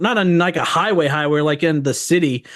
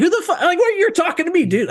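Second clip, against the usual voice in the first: mock outraged high yell; 14 semitones higher than usual. Retold confrontation at donut shop.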